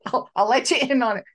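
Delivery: usual speaking voice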